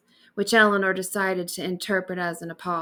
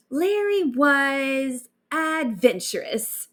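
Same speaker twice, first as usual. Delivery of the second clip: slow, drawn-out tactful understatement; understated; 5 semitones higher, slower than usual. Acted. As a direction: choose each word carefully, downplaying. Diplomatic understatement to friend.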